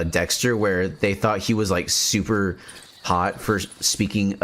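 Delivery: usual speaking voice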